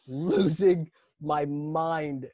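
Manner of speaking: very slow, stretched words; drawn-out emphasis. Retold or imitated. Comic describing his meltdown to audience, drawn out. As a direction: drag out every word for comic effect.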